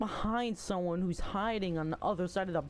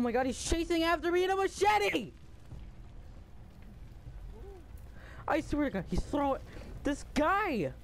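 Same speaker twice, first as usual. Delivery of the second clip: panicking gamer; 8 semitones higher than usual. Real, not acted. Chased by machete killer.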